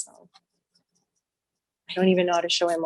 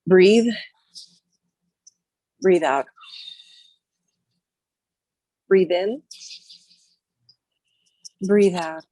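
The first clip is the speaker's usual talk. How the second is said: breathing cue; 4 dB louder, slower than usual. Shown as a demonstration. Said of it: "breathing instruction".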